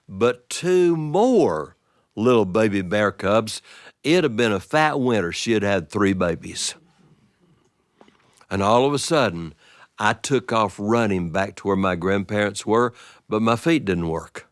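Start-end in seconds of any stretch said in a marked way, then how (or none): none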